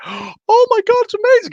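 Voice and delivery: a stylist gasping in delight, high and breathless; gushing stylist. Retold or imitated. Comic stylist gushing over a coat, squealing very high.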